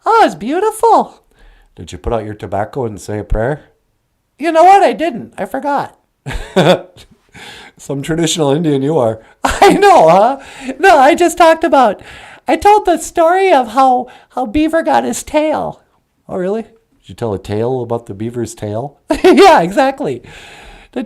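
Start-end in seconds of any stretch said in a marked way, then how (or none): 1.7-3.7 much lower, odd voiced character; low puppet voice. Puppet answering [name], deep voiced character. 7.8-9.2 much lower, odd voiced character; low puppet voice. Puppet answering [name], deep voiced character. 16.3-19.0 much lower, odd voiced character; low puppet voice. Puppet answering [name], deep voiced character.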